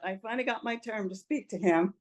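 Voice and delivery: usual speaking voice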